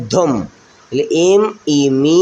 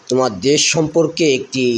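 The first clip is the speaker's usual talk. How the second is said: ordinary talk, nothing marked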